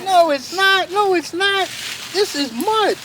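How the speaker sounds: high, firm denial; insistent little muskrat. Acted. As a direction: stamp his little foot.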